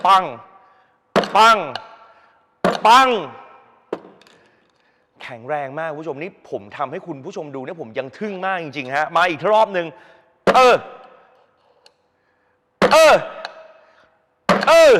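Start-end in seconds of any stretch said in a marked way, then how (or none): 0.1-3.1 short, loud "bang" with each blow; loud one-word impact noise; spoken bang. Shown as a demonstration. Voicing his hammer blows. 10.5-14.9 short, loud grunt on each strike; forceful hammering grunt; effort grunt. Shown as a demonstration.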